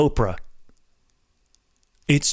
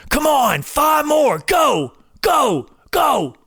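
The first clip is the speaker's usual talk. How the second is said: coach's yell; 11 semitones higher, much slower than usual. Retold or imitated. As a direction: bark it in their ear to drive them on. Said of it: "screaming in their ear".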